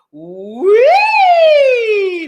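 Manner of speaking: very high, very loud siren wee. Shown as a demonstration. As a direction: shoot it up as high as it goes. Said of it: "That one went really high".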